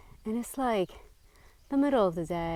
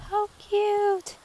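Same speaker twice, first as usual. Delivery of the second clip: much higher and louder, delighted squeal; 6 dB louder than usual. Real, not acted. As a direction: gush over something adorable. Finds a tiny fairy village.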